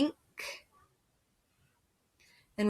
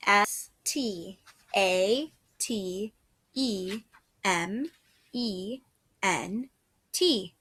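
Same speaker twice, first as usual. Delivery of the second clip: slow spelling; slower than usual. Shown as a demonstration. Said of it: "slow spelling for dictation".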